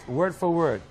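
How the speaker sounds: usual speaking voice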